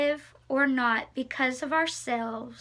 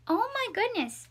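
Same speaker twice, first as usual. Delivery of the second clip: surprised; 8 semitones higher than usual. Real, not acted. Finds an old newspaper.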